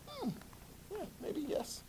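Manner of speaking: very high, soft little voice; high hesitant mumble. Acted. Teacher voicing a group conceding the point, high and soft. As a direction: answer tentatively, high and hushed. Acted reluctant answer to teacher.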